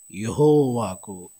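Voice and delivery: very slow and low, each word held; slow emphasis. Real, not acted. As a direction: savour the phrase slowly. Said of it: "ఇది రెండోసారి అంటున్నాడు". (నెమ్మదిగా, లోతుగా ఒత్తి చెప్పడం)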